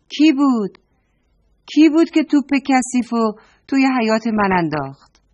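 high, loud, scolding aunt; angry shout. Acted. Aunt Morvarid angrily scolds the children over the ball. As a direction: scold the kids who threw the ball. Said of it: "خاله مروارید عصبانی و ناراحت".

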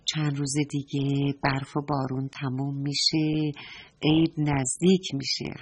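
low, soft, musing to herself; quiet inner voice of a storybook granny; talking to herself. A narrator's reading. (آرام، زیر لب با خودش)

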